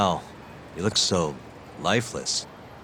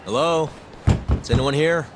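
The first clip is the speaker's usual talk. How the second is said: raised, high-pitched questioning call; 9 semitones higher, 4 dB louder than usual. Acted. Visitor checking if the closed club has anyone inside, calling out. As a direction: call into the place to find anyone.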